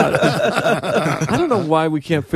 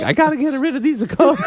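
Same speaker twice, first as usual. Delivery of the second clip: laughing through a fake Italian accent, pitched up; hammed-up fake Italian accent, half laughing; 11 semitones higher than usual. A shady coat seller to a buyer, in a hammed-up fake Italian accent. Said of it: "He did it with a fake Italian accent".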